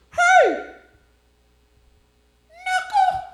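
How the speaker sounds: put-on high loud yell of a drenched kid. Drenched kid at the car wash yells at the comic, high and loud. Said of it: "Looks at me, he goes".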